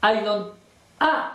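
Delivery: ordinary talk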